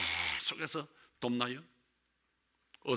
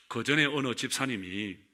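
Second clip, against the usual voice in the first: usual speaking voice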